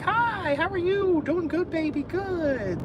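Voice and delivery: very high cooing greeting to a dog; re-enacting own high-pitched baby talk; super-happy dog talk. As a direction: soothe a dog in a squeaky, happy voice. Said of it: "super happy to 'em".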